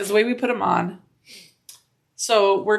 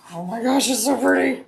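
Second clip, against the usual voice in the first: gushing; 5 semitones higher, 3 dB louder than usual. Real, not acted. Gushing over finished bag.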